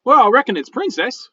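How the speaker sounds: high, loud critter voice; talking raccoon, folksy. Acted. Raccoon telling its owner its name, high and folksy.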